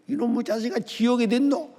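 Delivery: high-pitched mock indignant mother; imitated scolding. Mother retorting to son's warning of hell, imitated scolding.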